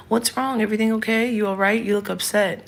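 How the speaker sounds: put-on high, prying concerned kid voice; mock concerned child. Kids asking mother if upset.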